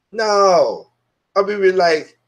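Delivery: ordinary delivery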